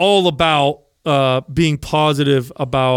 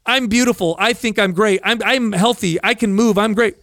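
higher-pitched mock self-affirmation; false positivity; 5 semitones higher than usual. Reciting body-positive lines to oneself, falsely upbeat. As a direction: chirp a hollow mantra, pitched up. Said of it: "the false body positive thing".

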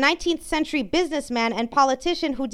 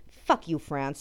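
ordinary talk

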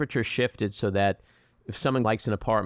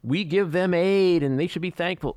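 high, louder mock of smug critics; sneering imitation of critics; mocking quote; 8 semitones higher, 4 dB louder than usual. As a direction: parody the critics' line. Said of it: "we hear people talking about".

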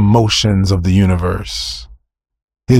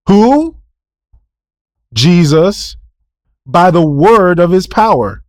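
fervent preaching; 7 semitones higher, 3 dB louder, slower than usual. Real, not acted. Preaching call and response.

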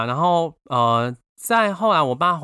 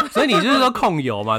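laughing; 9 semitones higher, 5 dB louder than usual. Real, not acted. Host laughing at friend.

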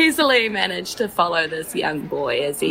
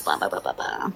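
mocking blah blah; 7 dB quieter, faster than usual. Boy mocks droning speeches.

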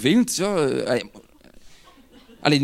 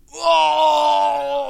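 a high, loud imitated wail of pain; mock pained cry; 12 semitones higher, 4 dB louder than usual. Retelling the thief's howl after the headbutt, a high mock wail. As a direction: ham up the injured man's howl. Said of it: "die begint dus te roepen".

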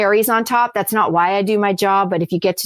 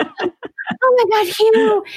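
much higher, slow, breathless swoon over Hugh; swooning fan; 14 semitones higher, much slower than usual. Retold or imitated. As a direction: melt like a starstruck audience member. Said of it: "they just turned into a puddle of mush".

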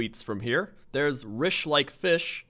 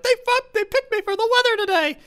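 high goofy voice; 7 dB louder than usual. Retold or imitated. Voicing Boalsburg resident.

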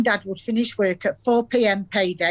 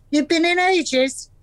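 mocking echo; 10 semitones higher than usual. Gran mocks husband's words.

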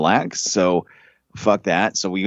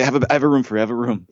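higher, lightly mimicked receptionist; front desk girl; 5 semitones higher, much faster than usual. Desk girl returning to the angry guest, lightly imitated higher voice. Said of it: "She's like".

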